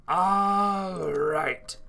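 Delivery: high, loud silly cartoon voice; loud high goofy voice. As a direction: belt it in a goofy voice. Repeating all right in voices.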